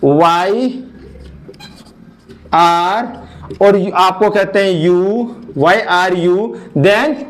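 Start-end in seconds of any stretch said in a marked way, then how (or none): none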